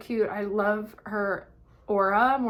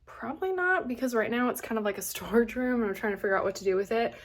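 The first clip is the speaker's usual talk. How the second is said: ordinary delivery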